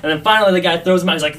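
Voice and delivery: ordinary talk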